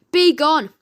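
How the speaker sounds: loud high command; scream. Acted. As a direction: yell to banish. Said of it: "They both screamed".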